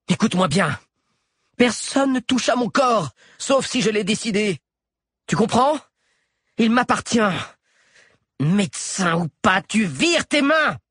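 put-on high, fierce young heroine's voice; furious patient refusing the doctor's hands; defiant young woman. A narrator's reading. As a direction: warn him off, hard and high. Alix confronts the doctor.

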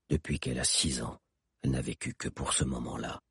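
low, hushed, solemn answer; grave voice. A narrator's reading. Hakan answering his friends about the revenge, grave low voice. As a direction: drop deep and quiet, gravely. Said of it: "répondit Hakan d'une voix grave".

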